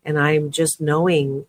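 normal talking voice

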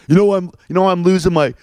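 high, mocking toothless mumble; toothless guy. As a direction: mimic a man with no teeth, mushy and high. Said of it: "he doesn't have any teeth".

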